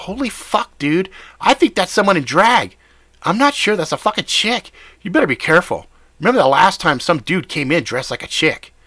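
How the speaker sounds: shocked buddy warning fast; alarmed high voice. Acted. Jimmy warns the narrator, alarmed.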